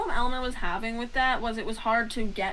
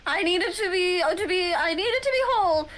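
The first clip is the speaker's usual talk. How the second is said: high, loud, rushed plea; desperate pleading; 8 semitones higher, 5 dB louder, faster than usual. Acted. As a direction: beg as if your life depends on it. NPC pleading insistently.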